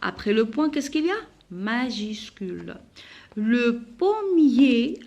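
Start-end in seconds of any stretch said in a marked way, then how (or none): 3.5-5.0 "Le pommier" stretched slowly, ending in é; "pommier" with silent R, long final é; ER pronounced as é. Shown as a demonstration. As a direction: dictate "Le pommier" as po-mié, pitched up. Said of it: "je la prononce E".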